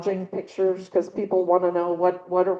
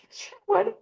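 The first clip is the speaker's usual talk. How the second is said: much higher, a breezy mock-casual yes; eager quoted reply; 13 semitones higher than usual. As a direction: toss it off high and easy, like it's no big decision. Retelling her own reply to Rob.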